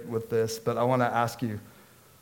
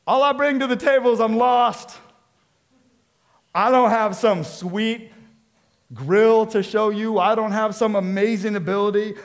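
pleading, higher and louder, slowing down at the end; lost sinner's plea; 6 semitones higher, 5 dB louder than usual. Acted. Lost sinner coming before Jesus, pleading. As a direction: beg like someone with nothing to offer.

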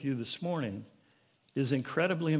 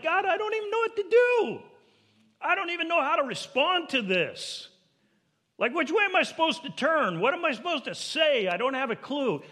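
very high, loud frantic prayer; desperate plea; 5 dB louder than usual. Acted. Preacher voices a lost believer praying, desperate plea. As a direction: cry out to God at a total loss.

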